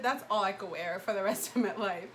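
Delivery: ordinary talk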